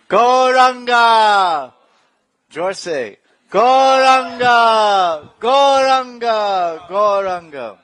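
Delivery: drawn-out high shout of Gauranga; ecstatic shout. Acted. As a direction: call it out to the sky, long and loud. Calling out the holy name.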